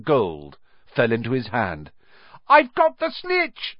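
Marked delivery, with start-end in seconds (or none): 2.5-3.8 high, slow, loud shout of victory; much higher boyish cry of triumph; triumphant shout. A narrator's reading. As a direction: yell it out, snitch held high. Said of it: "he shouted".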